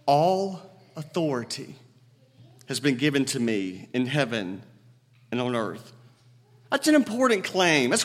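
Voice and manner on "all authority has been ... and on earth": solemn quote. Retold or imitated. Jesus to his eleven followers, low and solemn. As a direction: hand it down with quiet weight. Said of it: "makes that statement".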